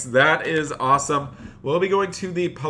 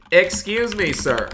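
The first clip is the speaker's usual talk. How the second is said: sharp call out; 6 semitones higher than usual. Acted. Banker yelling at a man on his counter, sharp call. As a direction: call out sharply across the bank. Banker shouting at brick thief.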